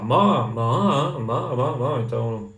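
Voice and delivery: MA said over and over, each on a different tone; Mandarin tones. Shown as a demonstration. Teacher showing students the tones, MA with shifting pitch. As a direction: run MA through changing pitch contours. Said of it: "depende do jeito que você falar ma".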